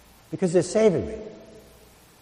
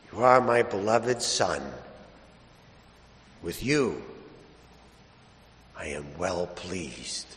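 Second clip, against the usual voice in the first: a slow, much softer, hushed voice from heaven; voice of God; 7 semitones lower, 6 dB quieter, slower than usual. Acted. The voice from heaven speaks to the Son, hushed and slow. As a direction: let the words fall gently, slowly, like a blessing.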